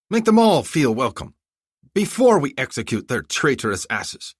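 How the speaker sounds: a smug commander laying out his plan; commander's orders. Acted.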